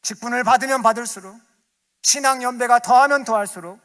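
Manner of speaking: loud, high exhortation building to a peak; full-force preaching. Acted. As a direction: drive the call home like a fiery preacher. (크고 높게 외치는 설교)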